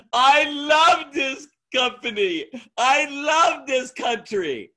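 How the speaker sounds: a proud newcomer in Middle Eastern broken English, gushing at the end; imitating broken English. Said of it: "he spoke very bad broken English".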